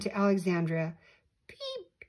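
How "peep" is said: very high, quiet croaky peep; croaked peep. A narrator's reading. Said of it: "he gro-, croaked".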